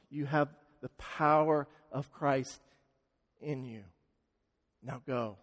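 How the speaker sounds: soft, tender blessing; quiet reassurance; gentle. Acted. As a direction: speak it lovingly, barely above a hush. Jesus comforts the woman, imagined.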